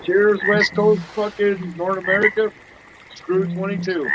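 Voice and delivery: loud, high toast; toasting cheer. Real, not acted. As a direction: raise a glass and call it out. Toasting the New Year.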